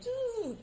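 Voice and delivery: a high, mock snore for a bored brain; snoring noise. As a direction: buzz out a sleepy zzz. Brain of uninterested three-year-old.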